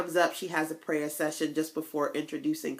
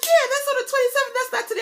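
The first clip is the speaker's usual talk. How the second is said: much higher, fast and chirpy; bubbly confirming; 5 dB louder, faster than usual. Retold or imitated. Young lady correcting the date, chirpy and high. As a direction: chirp the good news. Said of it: "She's like".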